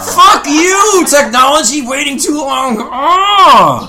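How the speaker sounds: mock anguished outburst. Real, not acted. Wails about missing internet porn.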